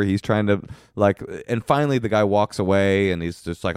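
normal talking voice

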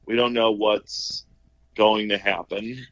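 usual speaking voice

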